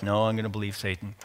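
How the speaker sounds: low, soft, quick mock mumble; acted sullen refusal, dropped low; dismissive refusal. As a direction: brush the encouragement off under your breath. Acted stubborn refusal to God.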